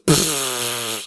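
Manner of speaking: long sputtering spray sound; spitting noise. Retold or imitated. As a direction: mimic a cloud spitting water. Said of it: "because then it goes".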